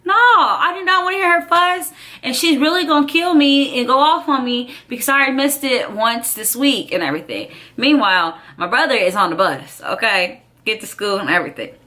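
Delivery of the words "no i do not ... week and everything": high, far put-on whiny voice; whiny reluctant self. Girl whining to herself about her mom. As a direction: whine it like a kid dreading trouble.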